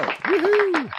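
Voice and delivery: high loud whoop; excited cheer. Real, not acted. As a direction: whoop it up with joy. Cheering the birthdays on air.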